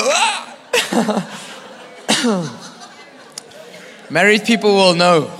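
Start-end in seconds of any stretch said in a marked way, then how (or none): 0.0-1.4 high-pitched comic "ah!"; mock yelp. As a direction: let out a high cry, then laugh. Acts out a sudden cry.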